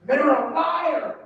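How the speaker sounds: slow, high shout of liar; a mock rally shout; shouted accusation. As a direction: yell it out slowly to the crowd. Speaker shouting accusation at event.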